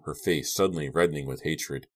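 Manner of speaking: usual speaking voice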